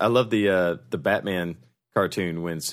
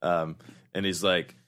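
ordinary delivery

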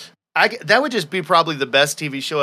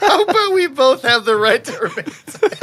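words breaking up with real laughter, pitched up; can't get the line out for laughing; laughing through it; 13 semitones higher than usual. Real, not acted. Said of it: "I don't know why that was so funny".

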